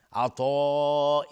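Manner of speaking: عطا with the final alif stretched very slowly; long madd. Shown as a demonstration. Teacher demonstrating the obligatory madd, drawn out. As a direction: hold the alif of عطا four to five beats. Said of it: "مد واجب متصل".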